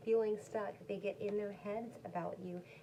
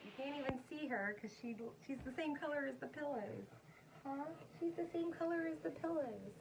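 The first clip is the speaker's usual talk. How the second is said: higher, softer doting pet voice; talking to a pet; 6 semitones higher, 4 dB quieter than usual. Real, not acted. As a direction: coo over the dog on the pillows. Talking about dog on couch.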